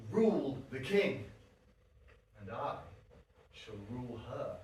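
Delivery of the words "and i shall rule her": much softer and lower, a private scheming murmur; sly aside. Acted. Suffolk alone on stage, quietly plotting to rule. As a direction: let the audience overhear your secret plot.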